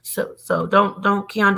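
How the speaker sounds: usual speaking voice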